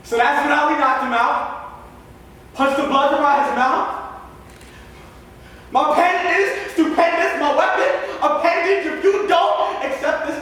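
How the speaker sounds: loud, high, hard-driven performance delivery; intense spoken-word monologue. Acted. Young poet performs a prison play monologue for the audience, intense spoken word. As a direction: project it full force like a fierce stage poet.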